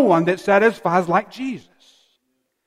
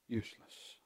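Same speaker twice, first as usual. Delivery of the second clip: whispered; 8 semitones lower, 25 dB quieter than usual. Acted. Soft drop on the key word.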